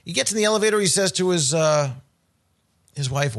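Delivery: ordinary talk, nothing marked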